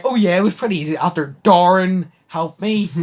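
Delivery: loud, high cocky jab; sassy comeback. Retold or imitated. Student taunting teacher with a loud cocky jab. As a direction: rub it in her face.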